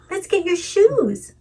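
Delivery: high, slow sing-song; melodic. Shown as a demonstration. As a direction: lilt it up playfully for a toddler. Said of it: "there's a very big difference between saying".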